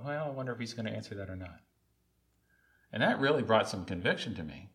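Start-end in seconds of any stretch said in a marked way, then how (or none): 0.0-1.6 quick, soft aside to oneself; softly retold wondering thought; doubting inner voice. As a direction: murmur it fast, like a private doubt. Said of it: "in my heart where I go".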